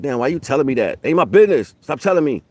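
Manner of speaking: imitating someone brushing off gossip; annoyed retort. Telling a gossiping friend to stop, mock irritated.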